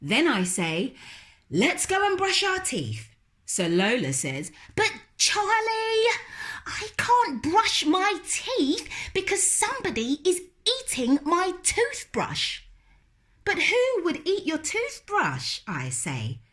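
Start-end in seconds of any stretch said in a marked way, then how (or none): 1.5-3.1 high, quick teasing brother; lightly voiced teasing brother. Brother teasing Lola about bed, quick. Charlie suggests. 4.8-12.6 put-on little girl refusing. Lola refusing to go to bed, high and stubborn. 13.4-15.6 high, quick teasing brother; lightly voiced teasing brother. Brother teasing Lola about bed, quick. Charlie asks.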